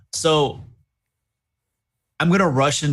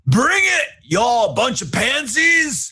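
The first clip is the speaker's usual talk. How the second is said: loud, high battle cry; screamed taunt; 13 semitones higher, 5 dB louder, slower than usual. Acted. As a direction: scream a drawn-out challenge at the enemy. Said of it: "I'm just gonna scream out".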